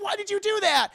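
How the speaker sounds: high loud why; shrill demand. Retold or imitated. Wife demanding why husband cheated, shrill.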